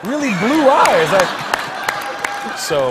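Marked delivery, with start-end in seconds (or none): 0.1-1.1 high, drawn-out mock protest; loud drunken protest. Tipsy comic defending compliments to the king, high and loud. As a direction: protest loudly, voice pitched way up, stretching it.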